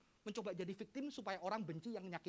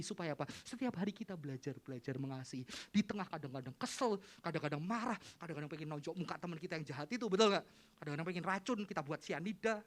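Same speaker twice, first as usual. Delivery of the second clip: usual speaking voice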